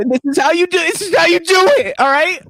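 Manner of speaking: high, mock-tearful insistence; put-on crying voice; fake crying. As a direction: act it out as if breaking down. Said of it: "He's fake crying until he actually starts".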